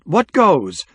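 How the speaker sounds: high, puzzled call; shout from a crowd. A narrator's reading. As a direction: onlooker calling out a question. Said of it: "some murmurings and scattered shouts".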